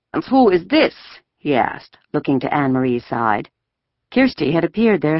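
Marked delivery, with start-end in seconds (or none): 0.1-1.0 soldier voice. A narrator's reading. Soldier asks.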